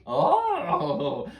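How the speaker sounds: high, loud witchy oh; a hag's delighted oh; witch voice. Acted. Witch reacts.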